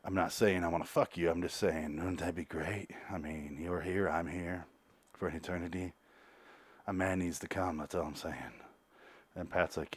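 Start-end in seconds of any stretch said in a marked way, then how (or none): 0.0-8.6 low, hushed, suggestive murmur; seductive come-on. Retold or imitated. Tate coming on to Pat, softly seductive. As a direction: proposition him quietly and low. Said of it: "really coming on to him".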